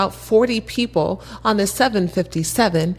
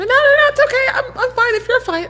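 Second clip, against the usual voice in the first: much higher, laughing insistence; eager young guy; 3 dB louder than usual. Retold or imitated. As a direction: play the pushy kid next to her, pitched up. Josh insisting, voiced.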